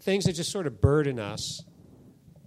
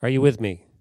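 louder call to the congregation; rallying question; 5 semitones lower, 7 dB louder than usual. Real, not acted. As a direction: wake the room up. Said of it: "preacher rallies congregation".

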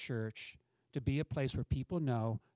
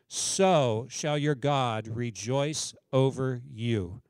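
scripture reading; 5 dB louder than usual. Acted. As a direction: read the verses out like a proclamation. Reads Isaiah aloud to congregation.

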